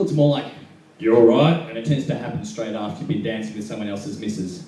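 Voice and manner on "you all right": louder, guttural local mimicry; guttural greeting. Comedian imitating the more casual local greeting, guttural. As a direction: throw it out from the throat. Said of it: "Here it's a bit more guttural".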